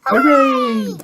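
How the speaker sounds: put-on high-pitched children's cheer; very high, drawn-out hooray. A narrator's reading. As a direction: cheer like a delighted little character. Children cheer the mended wall.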